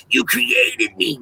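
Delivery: very slow, eerie accusation; very slow mock creepy voice of the woman with the Pokemon ball; creepy lady quote. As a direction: stretch every word out like a spooky stranger. Quoting the strange lady again.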